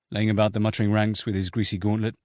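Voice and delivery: plain reading voice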